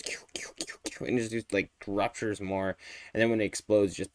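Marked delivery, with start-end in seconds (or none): 0.0-1.0 whispered kyu kyu effect; breathy mimicked shooting noise; whispered gun sound. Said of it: "and it goes".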